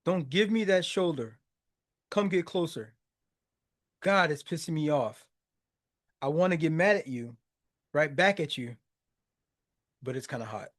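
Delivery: low, soft lyric reading; reciting lyrics. Acted. Reciting the bridge as she admits attraction, low soft lyric reading.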